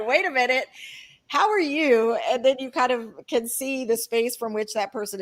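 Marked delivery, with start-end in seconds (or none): 0.1-2.2 "how are you?" asked much higher, as if it just dawned on you; sudden realization. Retold or imitated. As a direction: stop short, then lift "how" high with surprise. Sudden realization mid-quarrel.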